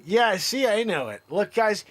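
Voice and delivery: usual speaking voice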